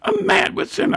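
high mock girl voice. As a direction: squeak it like a young girl. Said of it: "So Melvin Cross come down there".

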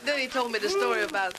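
ordinary delivery

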